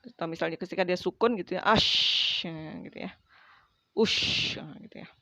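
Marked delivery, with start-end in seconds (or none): 1.6-2.4 ash with a long breathy sh; airy sukun shin. Shown as a demonstration. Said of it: "Jadi ada anginnya gitu ya". 3.9-4.6 ush ending in a spread sh; airy sukun shin. Shown as a demonstration. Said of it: "Jadi ada anginnya gitu ya".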